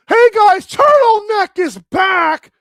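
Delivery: loud, high, hyped-up shout; excited announcement. Pretend. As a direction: crow it out with the last words stretched. Excited announcement of favourite's return.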